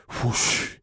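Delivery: a breathy blown whoosh; an airy whoosh of spat water; whooshing blow. A narrator's reading. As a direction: blow it out like spray. Fish blows water on people.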